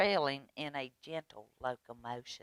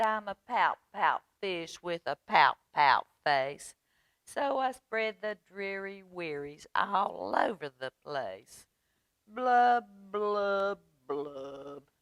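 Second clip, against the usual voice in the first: mock-dreary fish chant; glum pout-pout fish; 4 semitones higher, 5 dB louder than usual. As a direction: chant it rhythmically, blubs sinking in pitch. Voices the pout fish refrain.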